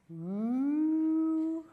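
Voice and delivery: high, slow, drawn-out taunt from the back seat; kid teasing singsong. Retold or imitated. Child in back seat teasing mom, high slow singsong. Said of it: "one of my kids goes".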